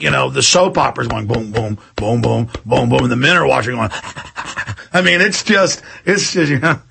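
usual speaking voice

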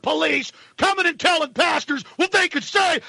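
normal talking voice

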